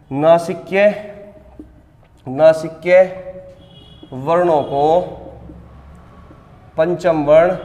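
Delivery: very slow, words stretched with long pauses; slow dictation. Acted. Teacher dictating the next point to students, slow and stretched. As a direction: dictate each word slowly for the copybook.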